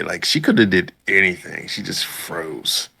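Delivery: ordinary delivery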